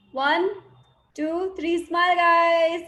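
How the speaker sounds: group-photo call with a long, lifted finish; photo countdown call. Real, not acted. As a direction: count them in, then sing out the last words. Second group screenshot countdown.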